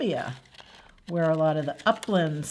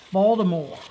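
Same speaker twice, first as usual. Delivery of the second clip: "Baltimore" in a Baltimore accent, Bawl-dih-more; 6 dB louder than usual. Shown as a demonstration. Mimicking the stepfather's family, a Baltimore-accent "Baltimore". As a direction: say "Baltimore" like a local. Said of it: "that accent is even a little bit wackier".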